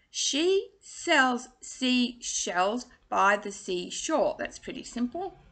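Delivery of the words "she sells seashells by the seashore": very slow, every word spaced; slow tongue twister. Shown as a demonstration. Coach modelling the twister slowly for students. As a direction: spell it out slowly. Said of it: "say them again for you".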